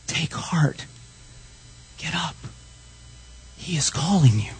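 hushed, breath-only exhortation; whispered encouragement. Real, not acted. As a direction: whisper the call gently to each listener. Preacher repeats the tender call.

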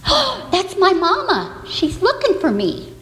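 put-on high excited baby chick voice. Baby chick spotting its mama, high and excited. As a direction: squeal it like a tiny chick spotting mom. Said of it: "said the chick".

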